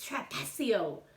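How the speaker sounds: ordinary delivery, nothing marked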